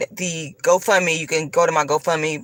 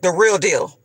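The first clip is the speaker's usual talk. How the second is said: ordinary talk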